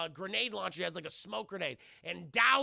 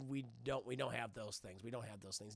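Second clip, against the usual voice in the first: hushed denial; 8 semitones lower, 9 dB quieter than usual. Retold or imitated. Butler voiced lying quietly.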